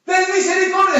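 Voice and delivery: high, loud automatic cry; insistent plea. Acted. Believer answers the Lord's question with a plea, crying out. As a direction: plead loudly without listening.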